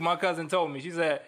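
ordinary delivery

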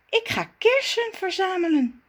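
put-on high, light girlish figure voice; Zaza's voice. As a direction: play the vain little Zaza, pleased with herself. Zaza's character voice. (hoge stem van Zaza)

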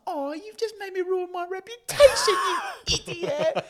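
very high, loud hammed-up fury; mock outraged shriek. Character turns on those filming her, mock outraged shriek. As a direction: scream it like a wronged diva, played for laughs. Acts out character turning on tricksters.

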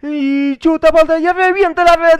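loud, high, drawn-out amateur commentary; slow commentator imitation climbing in pitch; attempted goal call. Shown as a demonstration. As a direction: build to a high shout on the goal. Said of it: "tengo que decir lo mismo que tú".

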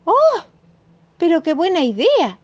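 gleeful surprise at good news; exclaiming mom. Acted. Mom hearing the cat took the pacifiers, delighted. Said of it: "exclamó mi mamá riéndose".

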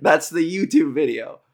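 ordinary talk, nothing marked

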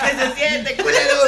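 high, hammed-up taunt; mock tough-guy taunt. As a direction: sneer it like a movie thug. Actúa al asaltante apuñalado. (burla exagerada de matón)

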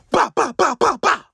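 high rapid bah bah gunshot noises; imitated gunshots. Narrator mimicking the gunshots, rising sharp bursts. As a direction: punch out each shot, rising in pitch.

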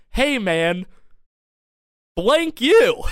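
mock-angry yell, building to a loud, high peak; angry retort. Friend turning on two passing fans, imitated angry yell. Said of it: "he turns around at them angrily".

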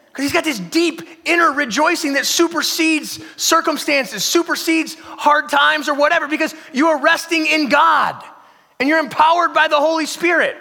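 high, loud preacher build; full-force preaching. Acted. Pastor rousing the congregation, loud and high. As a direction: preach it out with rising fire.

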